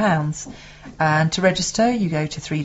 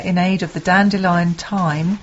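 ordinary delivery

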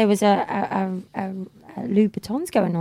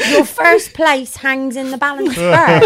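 mock threatening; 3 semitones higher, 8 dB louder, slower than usual. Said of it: "he put a threatening tweet".

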